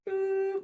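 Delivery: high-pitched imitated whistle toot; lunch whistle. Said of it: "whistle goes".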